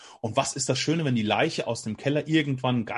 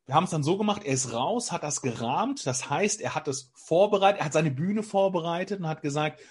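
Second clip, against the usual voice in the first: ordinary talk, nothing marked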